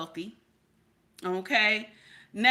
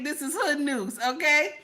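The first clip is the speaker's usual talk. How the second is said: ordinary talk, nothing marked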